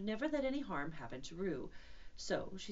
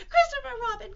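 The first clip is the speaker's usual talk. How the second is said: quick, very high loud squeal; crying out; 14 dB louder than usual. Acted. Piglet seeing Christopher Robin come in, quick high cry. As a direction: squeal the name in one rush. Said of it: "cried Piglet".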